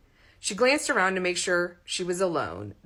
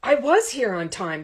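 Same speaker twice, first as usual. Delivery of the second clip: raised, louder indignant protest; 4 semitones higher, 7 dB louder than usual. A narrator's reading. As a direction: push back hard, offended. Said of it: "Todd protested".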